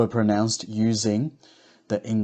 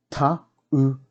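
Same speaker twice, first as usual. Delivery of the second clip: "Tauranga" split as Ta, u, ra, nga; broken into syllables; 4 semitones higher than usual. Shown as a demonstration. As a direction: sound out each syllable with gaps. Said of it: "break down the words first".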